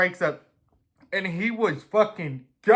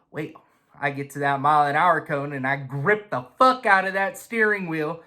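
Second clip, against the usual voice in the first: ordinary talk, nothing marked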